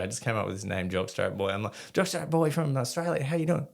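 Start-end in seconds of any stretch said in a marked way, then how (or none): 1.9-3.7 high-pitched mock presenter intro; put-on eager, high greeting; animated TV host. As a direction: greet a stranger as a zany documentary host. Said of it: "trying to be animated".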